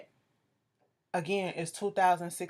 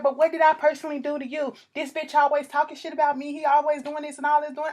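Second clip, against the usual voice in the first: raised, higher-pitched mock grievance; retold heated complaint; 8 semitones higher, 6 dB louder than usual. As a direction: act out someone loudly airing a grievance.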